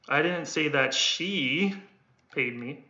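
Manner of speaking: "she" lifted, slow; weight on "she", ending dropping off; stress on she. Shown as a demonstration. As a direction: lean on "she". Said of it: "I'm only stressing the one that I'm holding".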